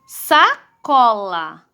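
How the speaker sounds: very slow, a gap between syllables; sílabas separadas. Shown as a demonstration. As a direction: sound out Sacola as SA-CO-LA, slowly. Teacher sounds out word by syllables. (leitura silabada, bem devagar)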